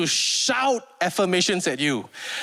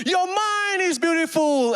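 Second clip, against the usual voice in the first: high, stretched-out instructor yell; shouted affirmation; 10 semitones higher, slower than usual. Retold or imitated. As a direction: belt out praise to rouse the class. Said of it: "for the next time she shouted".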